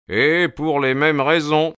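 lifted, emphatic interjection; Holmes interjecting. A narrator's reading. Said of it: "intervint Holmes".

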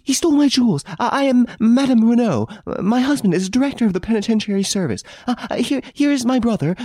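lady stammering out her story in a rush; panting for breath. A narrator's reading. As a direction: gasp it out to the police. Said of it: "Panting for breath".

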